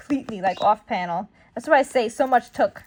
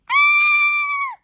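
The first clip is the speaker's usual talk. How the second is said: mock scream; 4 dB louder than usual. Acting out an attack.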